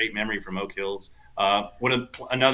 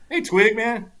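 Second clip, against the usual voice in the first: retold eager call; 12 semitones higher, 5 dB louder, slower than usual. Retelling his eager question to caddy.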